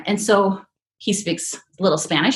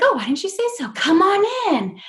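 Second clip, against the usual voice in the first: Oz gatekeeper; 10 semitones higher than usual. Retold or imitated. Gatekeeper letting Dorothy and friends inside, high and welcoming. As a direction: swing the gate open with a grand, lifted voice. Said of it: "And he's like".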